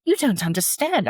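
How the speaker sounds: put-on high, emphatic British accent. Customer pleading with bakery staff for a sample, British accent. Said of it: "I said in my British, British accent".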